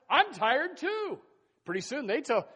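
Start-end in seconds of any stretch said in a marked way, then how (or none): 0.1-1.2 high, slow, loud whine; mock whine. Another member joins the complaint, high slow whine. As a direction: drag out tired like a moaner. Acted churchgoer joining in.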